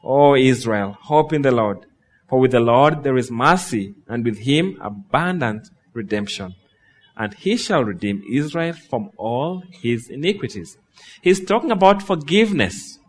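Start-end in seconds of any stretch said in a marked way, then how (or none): 0.1-10.8 scripture reading. Acted. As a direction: read the psalm like a prayer. Reads Psalm 130 aloud.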